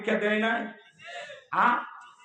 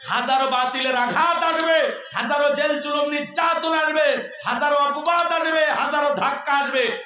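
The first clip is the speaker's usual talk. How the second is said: higher, louder rising sermon cry; fiery preaching; 7 semitones higher, 3 dB louder than usual. Acted. Preacher rallying listeners against persecution, loud fiery cry. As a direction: build the list into a preacher's roar.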